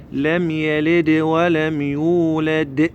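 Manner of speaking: slow chant, يلد and يولد each ending in a bounced "de"; qalqala on dal. Shown as a demonstration. Teacher tells learners to hear themselves, dal bounced. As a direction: recite slowly, let each د ring out. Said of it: "دائمًا اسمع روحك تقول د".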